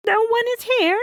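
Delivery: giant's wife. Acted. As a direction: cover for the boy, innocent. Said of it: "she told her husband".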